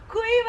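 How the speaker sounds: couille molle! savoured loud and high; relished insult. Acted. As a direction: spit out "couille molle!" with glee. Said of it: "je ne me lasse pas de cette expression".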